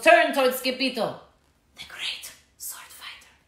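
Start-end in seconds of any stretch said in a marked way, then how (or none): 1.8-3.3 whispered in awe; awed whisper. A narrator's reading. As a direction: breathe out the title in wonder.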